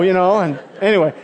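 ordinary talk

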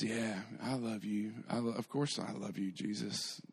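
soft, halting acted affirmation; subdued reply. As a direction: answer quietly, almost under your breath. Peter answers Jesus's question, acted.

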